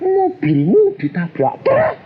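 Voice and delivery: high, slow mock shout; wife's yell. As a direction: cry out like the alarmed wife. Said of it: "Istri saya teriak". (istri berteriak panik)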